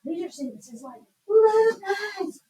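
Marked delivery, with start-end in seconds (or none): 1.3-2.4 high, excited call; exclamation with strong feeling. Shown as a demonstration. Teacher giving an example of an exclamatory sentence, excited exclamation. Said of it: "sentences that are showing strong emotion".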